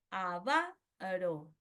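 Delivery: "avaro" slowly, a-VA... ro; stress on penultimate syllable. Shown as a demonstration. Teacher giving a grave example, slow "avaro" stressed on VA. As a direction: punch VA in "avaro", pause, let ro fall. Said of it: "en la penúltima".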